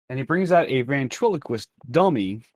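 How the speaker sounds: ordinary delivery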